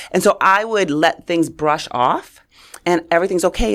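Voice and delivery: ordinary talk, nothing marked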